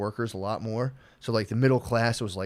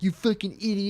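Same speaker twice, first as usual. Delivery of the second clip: higher-pitched imitated heckler; heckler's jeer, imitated; mock insult; 8 semitones higher than usual. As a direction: throw it out as a mocking jab. Imitating hostile people who come up.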